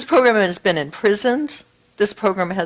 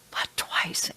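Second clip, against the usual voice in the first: hushed, awed but twice; whispered emphasis; 11 dB quieter than usual. Real, not acted. Stressing her achievement.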